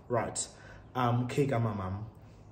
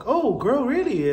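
high, loud mock disbelief; surprised; 11 semitones higher, 7 dB louder, slower than usual. Telling friend how she reacted, high surprised squeal. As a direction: gasp it out like you can't believe it.

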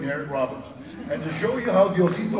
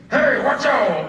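slow, louder hammed-up tough-guy warning; mock tough guy; 3 semitones higher, 5 dB louder, slower than usual. Mocking actors who tried to act hard, slow loud mock threat. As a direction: ham it up like a wannabe convict. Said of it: "everything they said was".

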